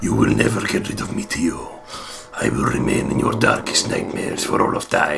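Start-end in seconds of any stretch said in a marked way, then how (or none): 2.3-5.2 low, unvoiced spooky threat; creepy doll voice. The cursed doll threatens Tio, creepy low whisper. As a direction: rasp it like a haunted doll.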